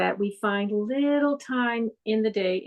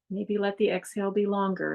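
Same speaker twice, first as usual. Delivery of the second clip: ordinary talk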